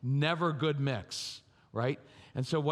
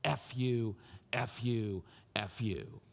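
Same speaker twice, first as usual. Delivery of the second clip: defiant mutter sinking lower and softer each time; defiant kid fading; 8 semitones lower, 5 dB quieter, slower than usual. Retold or imitated. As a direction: start low and fade to almost nothing. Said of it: "they will say".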